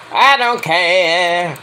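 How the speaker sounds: long drawn-out, mock-singsong dismissal; exaggerated indifference. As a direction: stretch every word to show how little it matters.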